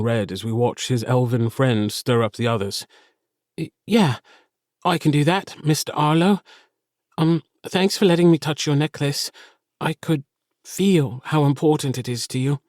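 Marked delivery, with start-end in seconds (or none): 3.6-12.6 put-on high-pitched, hesitant child voice; a shy kid stammering a little, earnest; shy young boy. A narrator's reading. Felix thanks Arlo for the necklace, shy and earnest.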